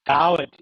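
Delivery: loud DAVDI, v straight into d; difficult pronunciation demo. Shown as a demonstration. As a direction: punch the first syllable of "Davdi", no vowel before the d. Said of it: "Maybe quite difficult".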